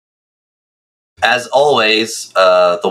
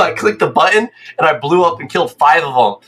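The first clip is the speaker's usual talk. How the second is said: normal talking voice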